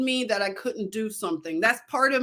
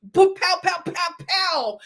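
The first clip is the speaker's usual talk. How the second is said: mock gunshots; 6 semitones higher than usual. Imitating gunfire from mob news.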